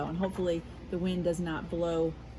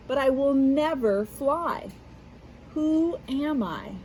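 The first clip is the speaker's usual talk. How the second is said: expressive, louder riddle-book reading; reading to children; 4 dB louder than usual. Acted. Teacher reading a picture-book riddle aloud to kids, expressive storytelling. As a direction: read it like a riddle for little listeners.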